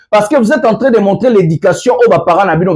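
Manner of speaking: usual speaking voice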